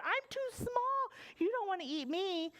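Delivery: high, pleading little goat; little billy goat. Acted. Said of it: "said the little billy goat".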